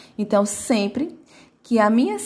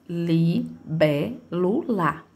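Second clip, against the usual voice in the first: "Libélula" broken into li, bé, lu, la; slow, separated syllables in "Libélula"; 3 semitones lower, much slower than usual. Shown as a demonstration. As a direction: count out "Libélula" slowly, one syllable at a time. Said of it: "ela tem quatro sílabas".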